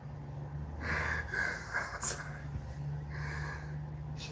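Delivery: long, real soundless laughing fit; helpless airy wheeze of laughter; silent laugh. Real, not acted. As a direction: laugh until no sound comes out. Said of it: "laugh when no sound come out".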